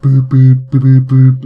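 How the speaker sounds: loud breathy flute-like boops. Shown as a demonstration. Streamer imitating the earthenware flute, loud boops. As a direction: puff each boop like blowing a bottle. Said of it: "hold onto it like that and go boop".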